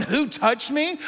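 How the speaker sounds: whiny diva asking who touched me; mildly mocking the question; mock prima donna. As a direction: play him like a prima donna. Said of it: "Are you being like a prima donna".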